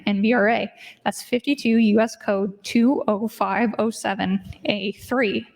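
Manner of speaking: usual speaking voice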